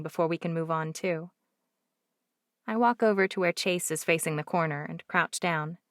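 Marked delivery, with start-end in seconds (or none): none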